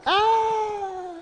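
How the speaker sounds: tantrum wail. Retold or imitated. Imagined tantrum on store floor.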